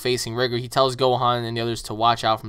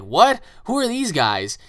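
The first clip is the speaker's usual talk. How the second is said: startled; 6 semitones higher, 3 dB louder, slower than usual. Retold or imitated. Rigger startled by newcomers.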